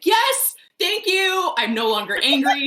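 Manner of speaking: loud, high-pitched jubilant outburst; retold celebration. Reader acting out her mini party at the grandmother's death, jubilant. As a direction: cheer it out as if partying. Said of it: "I had, like, a mini party".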